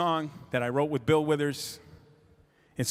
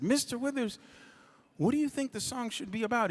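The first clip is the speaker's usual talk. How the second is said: respectful address; 9 semitones higher, 5 dB quieter, faster than usual. Retold or imitated. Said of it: "started calling him Mister Withers".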